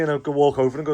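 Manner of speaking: ordinary delivery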